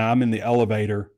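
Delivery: normal talking voice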